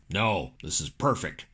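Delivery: deep, slow low-pitched politician; Dick Cheney. Acted. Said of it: "Dick said over the speakerphone".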